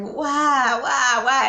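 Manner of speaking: mock tearful plea; retold crying. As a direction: lightly mimic a mother crying out why. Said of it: "she screamed and she bust out crying".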